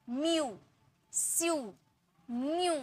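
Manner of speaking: म्यू, स्यू, म्यू with ee sliding into oo; "mee-oo", "see-oo" for म्यू, स्यू; ee glide. Shown as a demonstration. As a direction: model म्यू and स्यू slowly apart, ee audible. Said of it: "ई का sound आ रहा".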